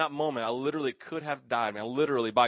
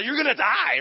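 higher, louder dire warning; mom's warning; 10 semitones higher, 7 dB louder than usual. Retold or imitated. Mom's voice in his head, high loud warning. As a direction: echo the mother's alarm. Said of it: "I hear my mom say".

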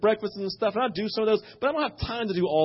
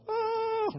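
retold yelp of alarm; 7 dB quieter than usual. His own retold shout.

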